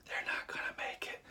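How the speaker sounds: stage whisper aside. Retold or imitated. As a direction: whisper it to the rest of the crowd. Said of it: "it's like".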